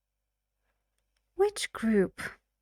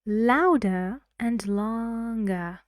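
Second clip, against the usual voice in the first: "louder and longer" with LOU and LONG loud and stretched; louder, longer stresses; 5 dB louder than usual. Shown as a demonstration. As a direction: lean hard and slowly on "louder" and "longer". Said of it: "Try to say the stressed syllables".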